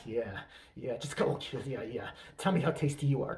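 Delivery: low, softened bedroom murmur; hammed-up pillow-talk voice; Armie Hammer. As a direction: play a mock seducer, low and hushed. Seducer continues cannibal bedroom talk.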